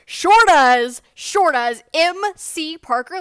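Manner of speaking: booming, gleeful yes; guy behind her loudly confirming she has a channel. Retold or imitated. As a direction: shout it out proudly.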